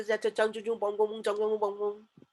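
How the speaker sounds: imitated Chinese-sounding gibberish; mock Chinese quarrel. Storyteller imitates two men fighting in China, mock Chinese gibberish. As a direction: babble in a mock Chinese accent. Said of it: "China के अंदर दो लोग थे, वो लड़ रहे थे".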